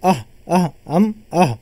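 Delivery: breathy aahs pushed out from the throat; breathy aah demo. Shown as a demonstration. Teacher exhaling aah to show air leaving the throat. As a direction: let the air rush out on each aah. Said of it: "हलक से हवा बाहर निकल रही है".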